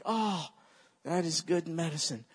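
ordinary talk

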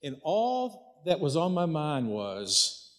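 normal talking voice

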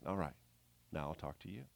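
soft, quiet, tender reply; gentle whisper. Retold or imitated. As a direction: let God answer in a gentle whisper. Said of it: "in a gentle whisper".

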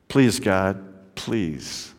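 low plea, fading away softly; desperate prayer. A narrator's reading. Despondent chaplain praying alone in his car, pleading. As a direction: plead quietly, last word nearly gone. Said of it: "began to pray out loud".

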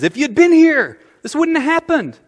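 a hint of hurt reproach to Jesus; Martha's reproach.

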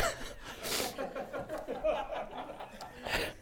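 breathless laugh through tears; shaky, emotional breath-laugh; tearful laugh. Real, not acted. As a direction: laugh it off while choking up. Breaks down during testimony.

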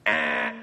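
low imitated buzzer blare; wrong-answer buzzer. Preacher rejecting an excuse, mock buzzer noise. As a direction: buzz them out like a game show.

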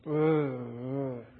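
a dazed, pained moan; muffled groans of agony; groaning in pain. Acted. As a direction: groan like a drugged man on the cross. Jesus drugged, moaning in pain.

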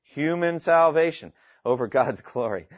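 demonstrated contrast, "Human salvation" prominent and "over God's glory" falling; change of intonation. Shown as a demonstration. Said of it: "Note the change of intonation".

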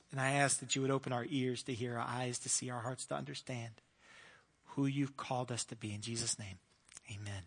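soft, low praying voice; hushed prayer, fading towards the amen. Real, not acted. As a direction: pray quietly and reverently on the congregation's behalf. Leads a prayer.

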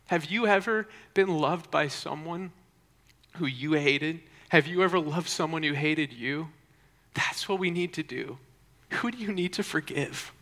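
intense, high, half-whispered sermon climax; impassioned preaching. Real, not acted. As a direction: press each point on the congregation, pausing after each.

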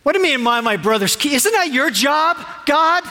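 accusing tone. Retold or imitated. Preacher voicing Cain talking back to God, fast and accusing. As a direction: throw it back at God, rapid and defiant. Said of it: "You hear that in the tone there?".

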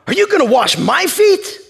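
high, loud, indignant question; acted-out disbelief; incredulous Peter. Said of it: "Peter said to him".

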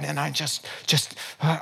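ordinary talk

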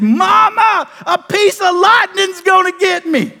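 high, loud, drawn-out yell for Mama; panicked child's cry. Retold or imitated. Child telling Mama about the lightning, panicked high holler.

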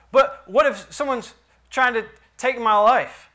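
a pleading what-if; worried objector. Pretend. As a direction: push back like a scared churchgoer. Preacher voices a listener's objection.

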